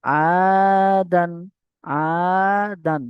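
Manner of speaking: slow, long-held chanted reading of the word; drawn-out recitation. Shown as a demonstration. Teacher chanting a word slowly for students. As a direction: stretch each syllable like Quran recitation. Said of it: "শব্দ জুড়ে দিয়েছে দেখুন".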